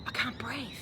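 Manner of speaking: fading, barely there. Acted. Repeated plea, faint.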